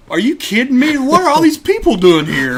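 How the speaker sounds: loud, high-pitched mock outrage; mad. Imitating Bubba upset at crowds on the corner, mad. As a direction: imitate a friend hollering in angry disbelief. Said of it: "Bubba's getting mad".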